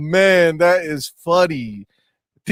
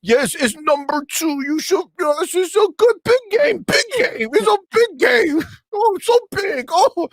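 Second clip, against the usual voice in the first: squeaky high sarcastic whine; mock them in a high squeal; 12 semitones higher than usual. Mocking the fans' claim.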